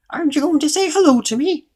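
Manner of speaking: very high, loud goblin question; little goblin voice. A narrator's reading. Goblin asking Mister Uppity for a hello, little high voice. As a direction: squeak it out like a tiny goblin. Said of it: "A little voice".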